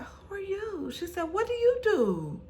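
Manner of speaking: high voiced, eager questions; curious woman. Candace asking Alicia, high eager questions. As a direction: ask it high and intrigued.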